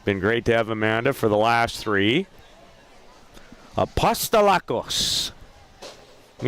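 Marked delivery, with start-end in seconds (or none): 3.8-5.3 Apostolakos in a mock Greek accent, stress on la. Shown as a demonstration. Commentator says the fielder's name for co-host, mock Greek accent. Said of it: "You do better when you add the accent".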